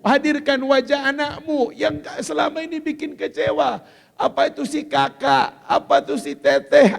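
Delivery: ordinary talk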